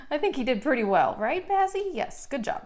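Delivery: laughing, high pet praise; dog voice. Real, not acted. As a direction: praise the dog, sing-song. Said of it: "I do use my dog voice in this video".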